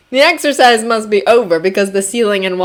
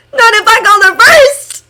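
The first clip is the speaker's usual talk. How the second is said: cheerful husband voice; 6 dB louder than usual. A narrator's reading. Hisashi's voice.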